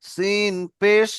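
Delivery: সিন পেশ drawn out very slowly; very slow spelling. Shown as a demonstration. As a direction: stretch সিন পেশ for the learner to hear. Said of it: "ভালো করে শোনেন।". (খুব ধীরে টেনে বলা)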